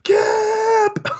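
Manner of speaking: very high, loud wailing teacher; panicked cry. Retold or imitated. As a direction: wail in hammed-up despair. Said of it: "And the teacher's like".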